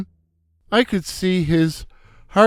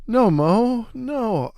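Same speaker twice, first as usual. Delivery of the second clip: protest; 5 semitones higher, 4 dB quieter, slower than usual. Acted. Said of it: "I protested".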